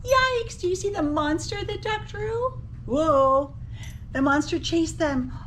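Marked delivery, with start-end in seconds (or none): none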